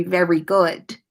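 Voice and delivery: ordinary delivery, nothing marked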